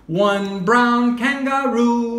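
slow, over-clear teacher voice; slow clear modelling. Shown as a demonstration. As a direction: say it slowly for a child. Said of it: "teacher models phrase slowly".

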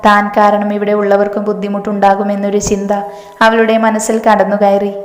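usual speaking voice

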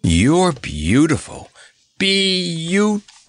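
slow, high, swooning bird; rapturous. A narrator's reading. Bird adoring the clown, rapturous. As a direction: stretch each syllable out, rising in rapture. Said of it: "He rolled his eyes rapturously".

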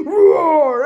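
loud far-off pretend monster roar. Kid roaring like a monster to scare Thomas. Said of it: "here it comes".

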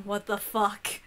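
usual speaking voice

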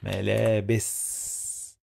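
"ملابس" slowly, with a long S at the end; held final S. Shown as a demonstration. Teacher showing learners the final sound, long held S. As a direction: stretch the final hiss of S in "ملابس". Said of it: "Sound of S in the end".

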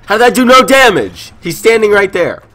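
high, loud disbelieving outburst; exasperated shout. Real, not acted. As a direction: yell at the broken game. Shot does no damage, exasperated.

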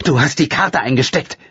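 mother accusing her daughter; angry call. Acted. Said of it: "rief Leonora zornig".

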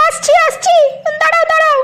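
high put-on housewife's voice calling back; wife's voice. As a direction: call back high as the busy wife. Wife answers husband from kitchen. (স্বামীকে সাড়া দেওয়া গিন্নির উঁচু গলা)

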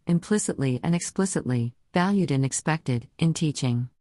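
synthesized text-to-speech voice; computer-generated voice. Real, not acted. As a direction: speak like a computer voice reading text. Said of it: "I use a computer-generated voice, as you can hear now".

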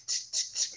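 soft, voiceless tongue ticks; imitated ticking. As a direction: tick quietly like a mechanism, no voice.

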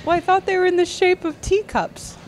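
imitating the upset bride, high and loud; mock sobbing. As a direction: whine it high as the distraught bride. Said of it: "she starts sobbing".

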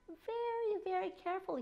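Very, very carefully, each very lifted high and stretched. A narrator's reading. Repeated for careful emphasis.

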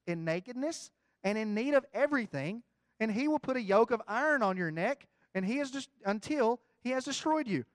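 thundering Bible reading; preached scripture. Acted. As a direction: declaim the curse like a prophet. Reads the curse aloud.

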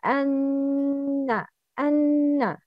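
أن held nasal for two beats; أن with a long nasal hum; two-beat ghunnah. Shown as a demonstration. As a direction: hum the nun two counts. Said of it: "غنة with two beats".

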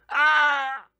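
loud high shriek of fright; terrified scream. Acted. Kenny sees the strange dinosaur, screaming in terror. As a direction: scream at the top of the voice. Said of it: "he screamed".

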